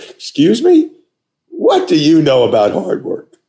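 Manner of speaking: very high, loud incredulous "Excuse me?"; shocked echo. Retold or imitated. Applicant stunned by the boss's jab, high and incredulous. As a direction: echo the insult back in disbelief. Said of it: "I go".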